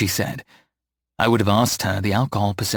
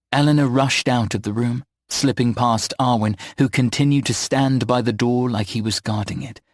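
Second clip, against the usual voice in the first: usual speaking voice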